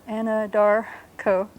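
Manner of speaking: "Anadarko" slow and careful; Caddo original. Shown as a demonstration. Teacher spells out "Anadarko" slowly for the class. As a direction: say "Anadarko" syllable by syllable. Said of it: "how that would be spelled in the original".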